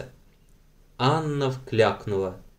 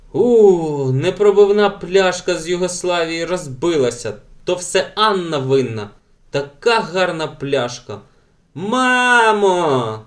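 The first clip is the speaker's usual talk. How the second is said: wailing; 5 semitones higher, 4 dB louder, slower than usual. A narrator's reading. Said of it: "Елен ридала".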